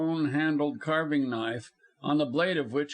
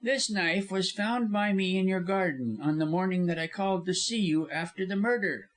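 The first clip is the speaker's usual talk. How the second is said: higher-pitched villain's voice; pointed, pitched-up accusation; accusing lawyer; 6 semitones higher than usual. A narrator's reading. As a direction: lay out the evidence against her. Lawyer makes his accusation.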